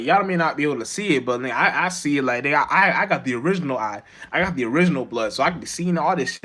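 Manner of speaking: usual speaking voice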